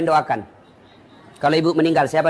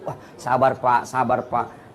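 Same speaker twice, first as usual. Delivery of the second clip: mock consoling; 12 semitones lower, 4 dB quieter, slower than usual. Preacher mock-consoling the widower.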